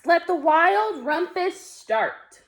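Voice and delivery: high, slow proclamation; crying out. A narrator's reading. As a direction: stretch it out like a grand royal command. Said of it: "cried Max".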